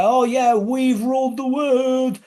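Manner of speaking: drawn-out grandiose colonial brag; mocking pompous English. Mocking English who think they ruled the world, high slow pomposity.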